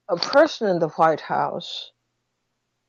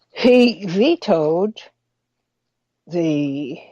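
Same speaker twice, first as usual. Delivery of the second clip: ordinary delivery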